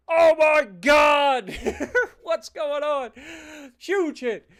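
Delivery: high, loud yell with a laugh; excited fan shout. Retold or imitated. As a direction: holler at the big hit on screen. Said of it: "you hear me go".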